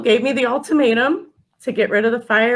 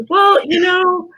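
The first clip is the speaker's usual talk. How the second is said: higher, louder, drawn-out lead-in; slow, raised-pitch hedge, recalling the interview; hedging interview answer; 10 semitones higher, 4 dB louder, slower than usual. Retold or imitated. Acting out own interview answer.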